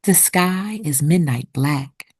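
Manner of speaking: usual speaking voice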